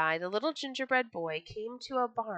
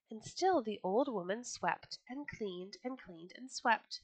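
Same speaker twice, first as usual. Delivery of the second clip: ordinary delivery, nothing marked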